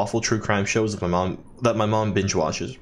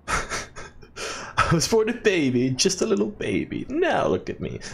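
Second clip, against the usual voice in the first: high, drawn-out put-on baby whine; silly mock voice; 8 semitones higher, much slower than usual. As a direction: climb higher and higher in a comic impression. Playful impression after laughing.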